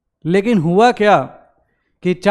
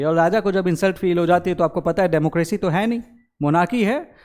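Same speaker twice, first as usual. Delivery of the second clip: usual speaking voice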